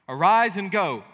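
loud commanding call; voice of God. Acted. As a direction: boom the command down from heaven. Voicing God's command to Jonah.

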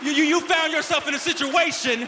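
loud, punchy preacher with stretched key words; full-force preaching. Real, not acted. Pastor telling sufferers there is a purpose, loud preaching. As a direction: drive each phrase home to the congregation.

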